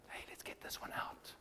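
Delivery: secret whisper, sneaking off. Pretend. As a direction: hush it like sneaking out at night. Sneaking back to the tomb.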